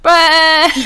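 loud sheep bleat shaped into a word. Retold or imitated. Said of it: "sometimes sheep sound like they're saying bread".

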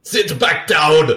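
loud, slow, high barked order; slow shouted command; mock command. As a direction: bark it like a movie tough guy. Acting a movie command.

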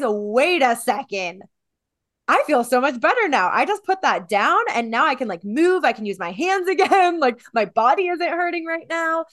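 light up as the weight is gone; joyful relief. Pretend. After putting the weight down, loud happy relief. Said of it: "And then you realize".

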